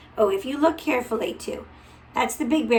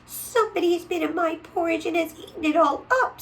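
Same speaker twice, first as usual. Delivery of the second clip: high, fast little bear voice; squeaky small cub, hurried; baby bear upset, high and fast; little wee bear; 7 semitones higher, faster than usual. A narrator's reading. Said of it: "in his little small wee voice".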